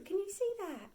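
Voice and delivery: plain reading voice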